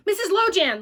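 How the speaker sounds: high, loud urgent call again; shouting. Acted. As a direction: call her name in alarm. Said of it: "I shouted".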